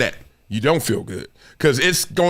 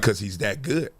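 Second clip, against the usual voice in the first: low, quiet reply; 8 semitones lower, 7 dB quieter than usual. Retold or imitated. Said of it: "They was like".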